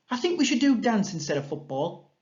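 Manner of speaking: put-on high, bright child's suggestion; voicing Nancy. Shown as a demonstration. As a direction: pitch the idea eagerly to friends.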